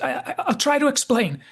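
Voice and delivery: high, loud stammering imitation; hammed-up frightened stutter; panicked stammer. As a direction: stumble over the first word in mock panic. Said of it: "and he stammers".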